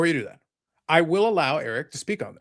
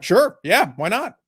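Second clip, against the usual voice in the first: mock agreement; 5 semitones higher, 6 dB louder, slower than usual. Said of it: "And I'm like".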